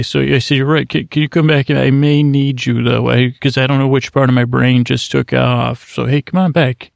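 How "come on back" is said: ordinary talk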